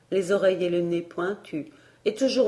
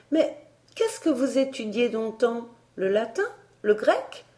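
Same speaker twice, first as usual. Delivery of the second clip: put-on curious girl; 5 semitones higher than usual. A narrator's reading. Elsie presses with questions.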